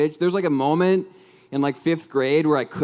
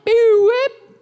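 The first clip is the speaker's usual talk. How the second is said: high, louder mouth-made whoosh and crack; zippy whip sound effect; 6 dB louder than usual. Shown as a demonstration. Comedian acting out drawing a J for the crowd, a high zippy whoosh. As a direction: zip it up high like a cartoon swipe. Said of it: "What if I just went ba with that".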